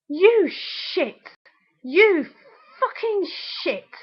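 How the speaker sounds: high, slow, spaced-out cursing; foaming with anger. Real, not acted. Swearing at the absent ex who broke her heart, seething. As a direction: curse them with drawn-out, seething force. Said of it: "foaming at the mouth almost".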